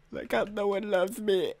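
high, whiny mock lament; mock self-pity. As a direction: pretend to wail that you're unloved. Mock self-pity after insulting sheep.

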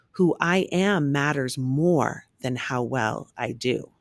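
ordinary delivery, nothing marked

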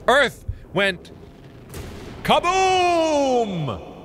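halting build to a long high KABOOM; hammed-up explosive announcement; dramatic boom. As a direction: pause between words, then stretch out "kaboom!". Mock theory, dramatic second take.

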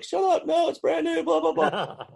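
very high mock-whiny protest; imitating nervous Pete. Pete refusing to speed his new car, squeaky mock whine. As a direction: mimic a panicky friend refusing, squeaky. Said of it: "he's like".

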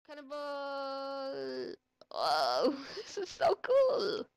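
loud whooping yell mid-flight; cannonball yell. Real, not acted. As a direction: yell as you fly from a cannon. Launched from cannon, yelling.

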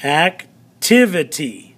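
"Activity" slowly, with a break after "ac"; teacher pacing out "Activity" in parts; sounded-out syllables. Shown as a demonstration. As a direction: say "Activity" as ac, pause, tivity. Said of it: "listen to the syllables in that".

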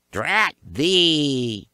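high, loud witchy cry; witches' yell. A narrator's reading. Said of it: "yelled the witch bodies".

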